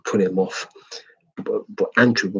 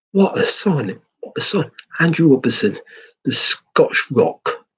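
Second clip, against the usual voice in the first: higher, louder excited outburst; excited praise; 6 semitones higher, 4 dB louder than usual. Real, not acted. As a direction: rave about the signing, pitch up. Fan raving about new signing.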